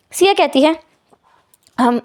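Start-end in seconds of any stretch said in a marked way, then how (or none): 1.8-2.1 soft unvoiced chuckle; a breathy little laugh to ease the tension; nervous laugh. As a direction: laugh it off awkwardly. Siya laughs awkwardly.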